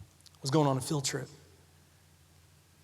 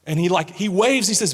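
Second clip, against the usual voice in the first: usual speaking voice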